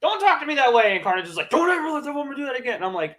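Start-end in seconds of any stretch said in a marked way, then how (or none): 0.0-0.9 much higher, louder retort; imitating Cletus. Cletus voiced, retold. 1.5-2.8 high hammed-up monster; imitating Carnage. Carnage repeats, retold.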